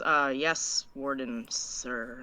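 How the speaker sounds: quiet, halting compliance; a little nervous. Acted. Inmate nervous alone with the warden, hesitant and hushed. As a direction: answer low and soft, hesitating before each title. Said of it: "she gets a little nervous being alone with him".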